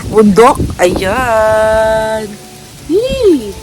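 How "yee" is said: high delighted yelp; excited squeal. Real, not acted. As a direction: squeal with joy. Excited at the grill.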